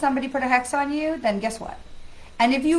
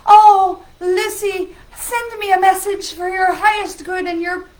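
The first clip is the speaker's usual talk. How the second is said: higher, louder, syrupy fake-sweet voice; mock spammer flattery; 6 semitones higher, 9 dB louder than usual. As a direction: ham up a fawning scam message. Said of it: "like, say".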